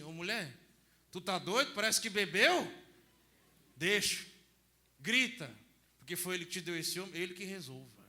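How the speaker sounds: loud, scolding onlooker voice; mocking bystander. Priest voicing a bystander jeering at the woman. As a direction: jeer like a neighbour who thinks she is drunk.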